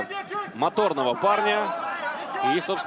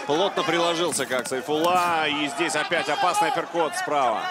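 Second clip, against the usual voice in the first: normal talking voice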